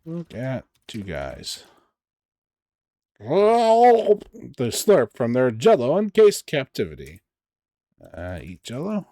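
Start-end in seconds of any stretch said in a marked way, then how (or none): 3.2-7.2 game narration. A narrator's reading.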